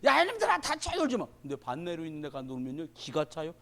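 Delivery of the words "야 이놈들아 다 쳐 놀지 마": higher mock scolding yell; hammed-up grown-up telling children off; elders scolding kids. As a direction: shoo the kids off like a grumpy village elder. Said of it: "어른들".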